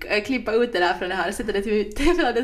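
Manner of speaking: high, loud, talking through laughter; laughing request. Real, not acted. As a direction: plead while cracking up. Laughing at co-host's sexy demonstration. (skrattande, ljust och högt)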